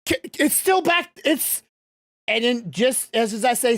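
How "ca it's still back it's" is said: frightened plea. Acted. GM voices frightened Kane begging.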